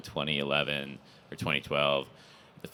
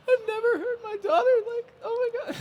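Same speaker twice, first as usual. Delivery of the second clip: squeaky, much higher teary mom imitation; mock crying mom; 4 dB louder, faster than usual. A mother overwhelmed by her daughter's words, mock tearful and high. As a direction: imitate a mother choked up with emotion, pitched way up. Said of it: "the mom's, like, crying".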